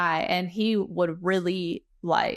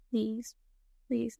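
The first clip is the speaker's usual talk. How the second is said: soft, halting plea under the breath; retold desperate prayer; 8 dB quieter than usual. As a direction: pray quietly, word by word. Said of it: "I'm just praying to fucking God".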